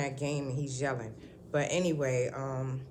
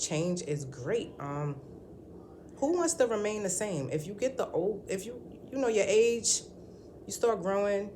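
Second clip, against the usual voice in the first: normal talking voice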